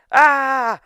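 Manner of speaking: loud, high drawn-out "ah"; mock frustrated scream. Shizuka alone after Sakura runs away, mock scream. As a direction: let out a comic cry of frustration.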